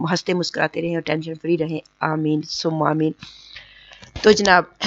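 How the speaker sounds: ordinary talk, nothing marked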